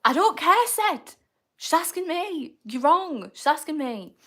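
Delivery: hammed-up petty argument; mock squabble. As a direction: snap back at a teasing friend. Playful squabble with chatter Seth.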